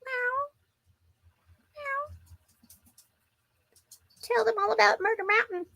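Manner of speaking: high, soft meowing voice; playful cat voice; mock cat voice. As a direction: pitch way up to play the cat. Voicing and coaxing cat.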